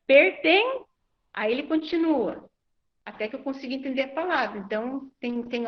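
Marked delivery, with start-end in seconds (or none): 0.1-0.8 "perten-" higher and louder, cut before the word ends; syllable prompting. Retold or imitated. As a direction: prompt with just "perten-", raised and left open. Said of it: "se eu entendo a segunda sílaba".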